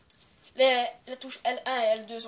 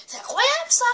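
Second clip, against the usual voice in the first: incredible; 11 semitones higher, 6 dB louder than usual. Real, not acted. Excited rant about rare event.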